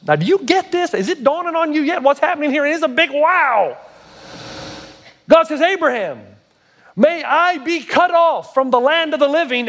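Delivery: high-pitched, emphatic preacher delivery; impassioned preaching. Real, not acted. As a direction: drive the message home with fervor. Preaches at rising intensity.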